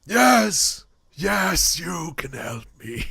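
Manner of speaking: loud, high, delighted yes; excited. Acted. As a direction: burst out with eager relief. NPC answers eager halfling.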